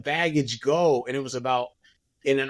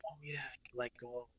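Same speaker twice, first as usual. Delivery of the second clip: dreamy murmur; 3 semitones lower, 21 dB quieter than usual. Retold or imitated. Retelling himself drifting off.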